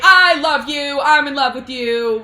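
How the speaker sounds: loud, high, insistent confession; mock argumentative declaration. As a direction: shout the love like an argument, hammed-up. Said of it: "so then they start arguing".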